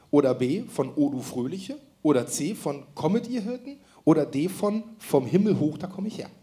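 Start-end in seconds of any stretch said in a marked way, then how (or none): none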